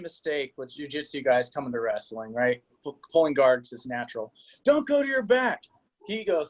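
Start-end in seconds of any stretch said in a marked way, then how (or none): 4.6-5.6 high yelled warning; coach yelling again. Retold or imitated. Coach warns the pinned sophomore, high yell. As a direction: shout it from the corner. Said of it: "I yell".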